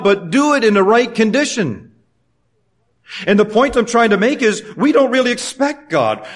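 impassioned pulpit delivery; full-force preaching. Acted. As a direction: build the exhortation to the church. Preaching rises again.

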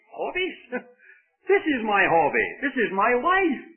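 high, amazed echo, then emphatic insistence; incredulous retort. Retold or imitated. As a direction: act out an athlete scoffing at the question. Athlete scoffs at question about hobbies.

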